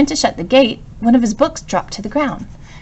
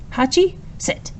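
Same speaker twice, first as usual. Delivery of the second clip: slow, firm dog command; serious command; 11 semitones higher than usual. A narrator's reading. Professor giving his puppy a firm command. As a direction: say it like a serious order. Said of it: "gave the command in a serious tone of voice".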